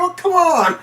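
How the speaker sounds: very high, pleading whine of protest; mock exasperated protest. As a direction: act out a desperate objection. Retold protest from man dangling.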